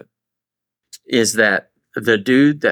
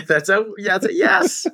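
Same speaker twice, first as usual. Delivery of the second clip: high-pitched laugh through the words; cracking up mid-sentence; 9 semitones higher than usual. Real, not acted. Laughing at the French attempt.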